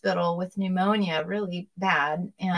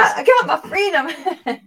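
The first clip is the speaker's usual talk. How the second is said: laughing; 8 semitones higher, 6 dB louder, slower than usual. Real, not acted. Laughing joy over freedom.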